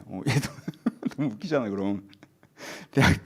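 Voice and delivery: ordinary talk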